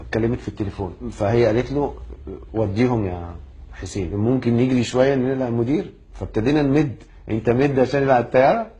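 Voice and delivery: ordinary talk, nothing marked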